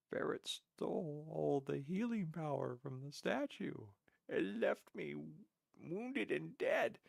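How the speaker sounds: high, drawn-out mock lament. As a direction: lament it theatrically, pitched well above normal. Teller hams up co-op grievance.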